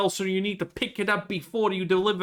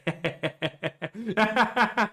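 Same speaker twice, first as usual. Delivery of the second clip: laughing; 3 semitones higher than usual. Real, not acted. Laughing at his own joke.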